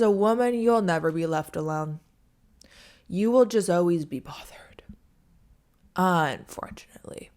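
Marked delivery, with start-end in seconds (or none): 3.1-7.3 whispered, resigned aside; wry whisper. Real, not acted. Warning women viewers about being bothered, whispered wryly. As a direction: let it out as a rueful secret.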